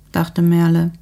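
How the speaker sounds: ordinary delivery, nothing marked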